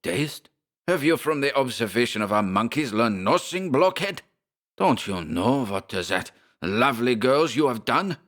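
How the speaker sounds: high, scornful German rant; angry German scolding. Acted. Schmersholt scolds the narrator in a German accent. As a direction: berate the blockhead in a German accent. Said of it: "snapped Schmersholt".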